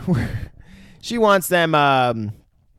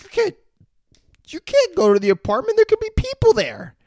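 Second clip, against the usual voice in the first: much higher, louder pleading woman's voice; frightened woman; 5 dB louder than usual. Retold or imitated. Retelling the plot, imitating the scared woman begging him to stay. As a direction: beg him not to go, in a squeaky put-on voice.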